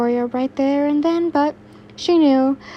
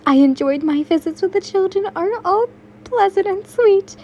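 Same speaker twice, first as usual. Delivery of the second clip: beaming; 10 semitones higher than usual. Acted. Yin beaming about the orphanage.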